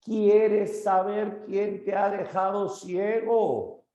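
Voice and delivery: grito muy agudo y lento, desde lejos; llama al cíclope burlón desde el barco; Odiseo gritando. Acted. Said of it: "Odiseo grita". (Odiseo desafiante gritando desde el barco)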